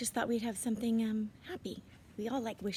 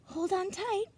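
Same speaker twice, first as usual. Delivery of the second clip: put-on high eager kid; 9 semitones higher than usual. A narrator's reading. Charles to squirrel.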